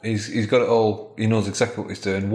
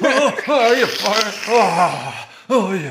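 much higher and louder, furious cursing; angry shout; 12 semitones higher, 5 dB louder, slower than usual. Acted. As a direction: explode at the fallen tree. Husband shouting at tree.

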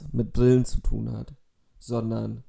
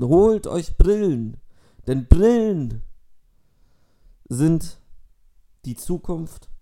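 hammed-up persuasive speech; mock school presentation; 3 semitones higher, 8 dB louder than usual. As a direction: overblown earnest lecture.

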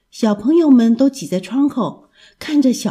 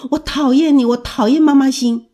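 put-on raised, higher-pitched child's protest; loud outburst; 5 semitones higher, 5 dB louder than usual. A narrator's reading. 阿志 lashing out at teacher, in a raised voice. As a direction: throw it at the teacher, loud and high. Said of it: "阿志大声地对老师说".

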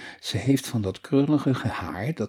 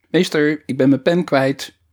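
put-on girl calling across the class, louder; 4 semitones higher, 6 dB louder than usual. A narrator's reading. Ellemieke calls to the teacher across the class, calling out. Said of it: "riep Ellemieke door de klas".

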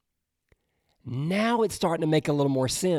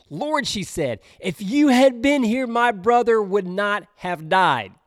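higher, louder grieving cry to Jesus; Mary pleading with Jesus, higher and louder; anguished plea; 7 semitones higher, 7 dB louder than usual. Acted. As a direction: cry it out as a sister who lost her brother. Mary's grieving reproach voiced.

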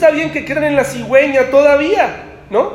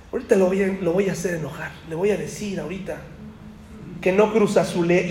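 scheming murmur; 4 semitones lower, 9 dB quieter, faster than usual. Retold or imitated. Scheming relative plotting provocation.

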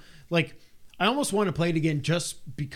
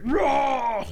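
high, loud mock monster roar; beast roar; 11 semitones higher, 4 dB louder than usual. Beast man roars at the player, loud.

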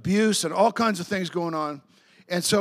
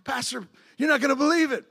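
breathless excitement; 6 semitones higher than usual. Retold or imitated. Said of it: "He's all out of breath".

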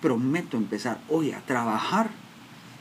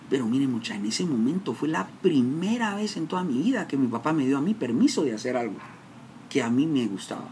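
ordinary delivery

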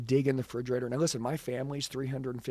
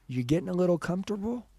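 retold needling, higher-pitched with a rising end; needling question; 6 semitones higher, 3 dB louder, faster than usual. Wife grabbing husband's love handle, needling. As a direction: poke at him lightly, lifting the question upward.

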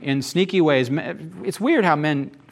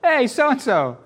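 mock street yell; 9 semitones higher, slower than usual. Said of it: "he yelled".

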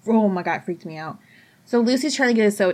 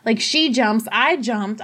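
normal talking voice

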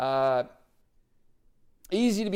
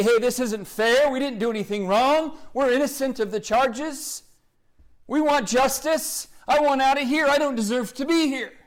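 higher, whiny protest of an unjust prisoner; complaining prisoner; 7 semitones higher than usual. Retold or imitated. Preacher imagining himself jailed, complaining at a higher pitch. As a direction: complain like you've been wrongly jailed. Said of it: "complain and to say".

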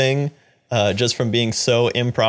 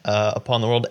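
ordinary delivery

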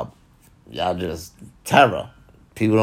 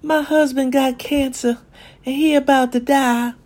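high, distressed voice of a woman confiding; grieving confider. Retold or imitated. Wife telling a friend her husband is dying, high and distressed. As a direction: play the upset wife sharing bad news. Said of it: "You go tell somebody".

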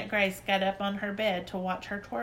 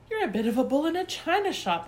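disapproving gasp; 9 semitones higher, 4 dB louder, faster than usual. Acted. Nana's disapproving remark.

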